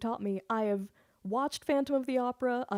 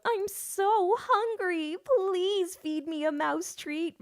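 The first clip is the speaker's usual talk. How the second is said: high, slow whiny pleading voice, louder; horrible begging voice; 9 semitones higher, 3 dB louder, slower than usual. Retold or imitated. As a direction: whine like a starving pet begging. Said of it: "I put on this really horrible voice".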